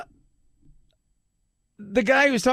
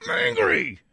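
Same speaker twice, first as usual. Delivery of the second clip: much higher, hammed-up sulky anger; mock angry judge; 13 semitones higher than usual. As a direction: ham up a sulking judge, pitched way up. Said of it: "He's angry".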